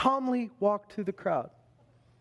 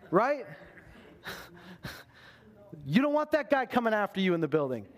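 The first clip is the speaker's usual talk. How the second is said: ordinary talk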